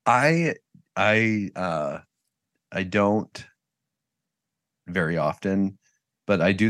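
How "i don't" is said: ordinary talk, nothing marked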